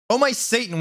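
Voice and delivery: high-pitched exclamation; mock scream. A narrator's reading. Caught in the coffin, mock scream. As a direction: cry out high without full volume. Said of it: "we screamed".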